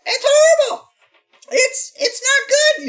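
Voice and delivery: high, loud squeaky yelp; yelping Toad voice. Acted. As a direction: yelp it like a panicked mushroom. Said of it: "Yelps Toad".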